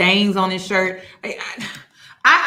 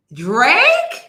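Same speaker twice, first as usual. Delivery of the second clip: loud disbelief; 4 semitones higher, 8 dB louder than usual. Real, not acted. Incredulous repeating, escalating.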